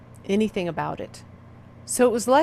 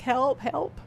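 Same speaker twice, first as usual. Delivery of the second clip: a mild imitation of a sobbing plea; a hint of a tearful, higher cry; crying for help; 5 semitones higher than usual. A daughter on the phone to her mom, lightly acting her sobbing plea. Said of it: "crying and sobbing, like".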